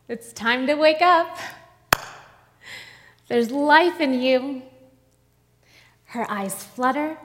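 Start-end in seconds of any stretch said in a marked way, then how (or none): 0.1-4.6 put-on louder and higher, commanding the girl awake; Jesus calls the dead girl back to life, loud and commanding; rousing call. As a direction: raise the voice to wake her.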